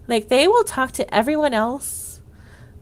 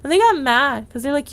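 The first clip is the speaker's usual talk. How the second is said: normal talking voice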